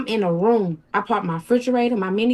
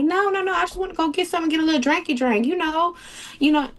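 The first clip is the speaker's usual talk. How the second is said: higher, sing-song coaxing to get the keys; fake-casual higher voice to sneak away; sweet innocent act; 7 semitones higher than usual. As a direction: play it innocent, pitched up. Acting her sweet cover story.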